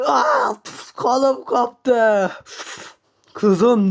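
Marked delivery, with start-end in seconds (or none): 0.1-0.5 very high shocked shriek; piercing high cry of pain. Acted. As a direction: yelp as if the arm just came off. Arm gets torn off.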